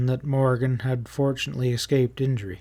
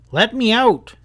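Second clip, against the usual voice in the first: demanding shout; 9 semitones higher, 6 dB louder than usual. Acted. Prisoner demands release.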